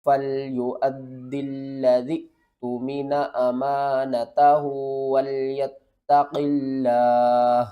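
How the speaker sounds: melodic tajweed recitation, rising to a loud close; Quranic recitation. Shown as a demonstration. Teacher recites the verse for students, melodic recitation. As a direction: chant the verse with full tajweed.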